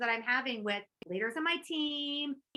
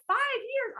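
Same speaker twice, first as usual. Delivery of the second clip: high incredulous squeak; can't believe the plan is that long; shocked disbelief; 11 semitones higher than usual. Retold or imitated. Coach reacting to a five-year plan, high and incredulous. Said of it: "I'm like".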